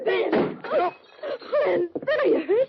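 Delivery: panicked, crying out to the wounded man; frightened cry. Acted. Woman cries out to Ben after the shot, frightened.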